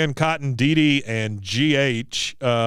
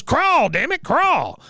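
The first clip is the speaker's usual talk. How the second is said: high-pitched drill-sergeant commands; Cotton; 14 semitones higher, 4 dB louder, slower than usual. Retold or imitated. Veteran ordering Peggy across the floor, imitated high-pitched barking. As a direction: imitate an old soldier ordering someone onward. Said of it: "He goes".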